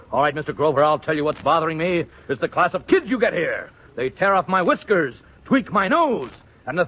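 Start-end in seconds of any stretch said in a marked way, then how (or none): none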